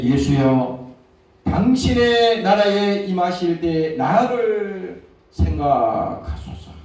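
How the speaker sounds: very slow, earnest plea; pleading prayer. Acted. Dying thief begging Jesus to remember him, very slow. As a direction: stretch each word out as a dying plea. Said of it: "가로되".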